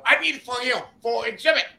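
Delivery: high-pitched, put-on broken English; imitated foreign buyer. Buyer explains he wants it for exhibit, high mock voice. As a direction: push the voice up high, insistent.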